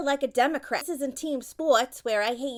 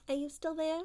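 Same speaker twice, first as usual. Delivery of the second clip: ordinary talk, nothing marked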